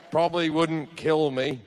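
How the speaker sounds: usual speaking voice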